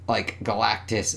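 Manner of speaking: ordinary delivery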